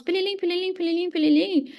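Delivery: high, sing-song "pililim" like a sales notification chime; mimicked chime. Imitating the Hotmart sale chime for the host. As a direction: chirp "pililim" the way the app pings.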